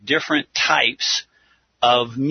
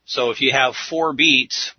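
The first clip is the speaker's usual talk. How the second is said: ordinary talk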